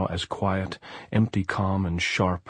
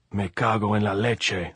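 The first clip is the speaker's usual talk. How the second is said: exasperated curse; 2 semitones higher than usual. A narrator's reading. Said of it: "Andres shouted".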